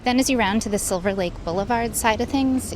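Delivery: ordinary talk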